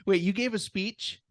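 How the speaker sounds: high, loud laughing "wait"; laughing surprise. Real, not acted. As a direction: burst out in amused disbelief. Laughing disbelief at story.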